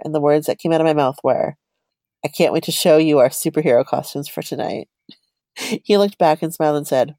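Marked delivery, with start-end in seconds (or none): none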